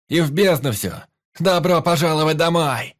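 loud, high rallying shout; rousing toast. Acted. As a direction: shout the toast to the whole crew. Climax of toast to crew.

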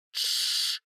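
unvoiced hush with finger to lips; shushing. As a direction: hush them urgently. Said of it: "приставляет палец к рту".

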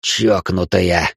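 slow, spat-out insult. Acted. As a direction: spit the word in disgust. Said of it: "сплюнул хмырь".

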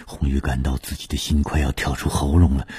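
ordinary delivery, nothing marked

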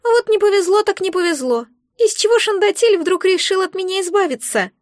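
high, whiny little creature; complaining. A narrator's reading. Egg complains about its old owner, whiny complaint. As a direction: lament in a high squeaky voice. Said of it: "и пожаловалось".

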